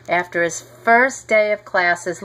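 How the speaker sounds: plain reading voice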